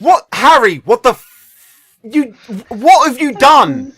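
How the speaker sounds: high, loud disbelieving outburst; loud exasperated shout. Real, not acted. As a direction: bellow at the player in disbelief. GM yells at broken sheet.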